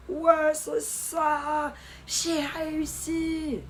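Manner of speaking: high, drawn-out victory cry; triumphant cheer. Real, not acted. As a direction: celebrate loudly, stretching each ending. Se réjouit d'avoir réussi sa commande. (cri de victoire aigu et traînant)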